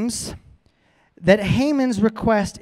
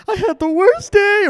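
high, louder complaining wail; whining Haman; 13 semitones higher, 4 dB louder than usual. Pretend. Haman comes home humiliated and moans, high and loud. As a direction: wail it out like a sulking man. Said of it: "He comes home".

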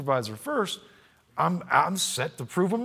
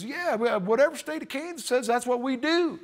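easygoing rural official; 9 semitones higher than usual. Retold or imitated. Building head reassuring the caller, easygoing and high. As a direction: casually agree like it's obvious. County official voiced in story.

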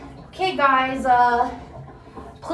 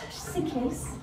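whisper; 10 dB quieter than usual. Real, not acted. Whispered echo after sigh.

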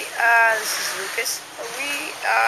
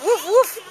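playful woof; 8 semitones higher, 5 dB louder than usual. Pretend. Barking at dog.

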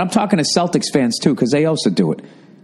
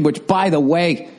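ordinary delivery

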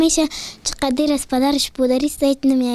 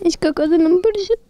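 real tearful voice; 3 semitones higher than usual. Real, not acted. Crying girl telling uncle her heart is full, tearful. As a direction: choke out a small tearful answer.

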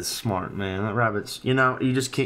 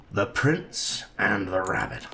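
1 storybook title; 3 semitones lower, slower than usual. Acted. Reads storybook title aloud.

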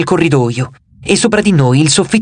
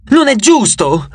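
put-on a boy's raised, bitter protest; angry outburst; 7 semitones higher, slower than usual. A narrator's reading. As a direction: cry out at the unfairness. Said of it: "gridò Nico".

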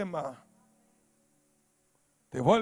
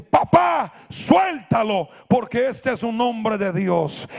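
loud high cry, slowing into a plea; imitated child's urgent yell; girl shouting; 3 semitones higher, 8 dB louder, slower than usual. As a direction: cry out to papa as a child would, retold. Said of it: "sale una niña gritando de la casa".

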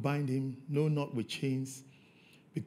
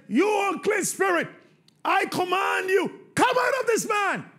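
high, loud shouted exorcism command; 11 dB louder than usual. Acted. Jesus commanding the unclean spirit, shouted. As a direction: order the spirit out with full force. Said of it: "And Jesus said".

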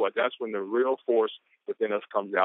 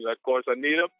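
ordinary delivery

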